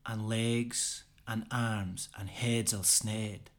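slow, measured Scots poem recital; poem recitation. Acted. Reciter gives Burns' Address to a Haggis, slow declamation. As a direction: declaim it slowly, line by line, with weight.

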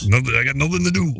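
put-on wrestler impression; hammed-up wrestler grumbling; Macho Man. Co-host imitating an idle wrestler, hammed-up wrestler voice. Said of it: "And Macho Man's like".

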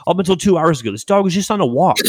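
incredulous protest, pitch jumping high; stunned outburst, rising into high-pitched protest; retold disbelief. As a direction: argue with news you can't believe. Disbelief at tumor diagnosis.

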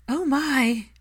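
high delighted exclamation; thrilled and amused gasp of words; vastly entertained. A narrator's reading. Andromeda hears of the engagement, delighted and high. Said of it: "Andromeda sounded vastly entertained".

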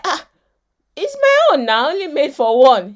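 high, loud teasing; teasing wife. Retold or imitated. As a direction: tease him that the food is hers. Wife teasing husband over her food.

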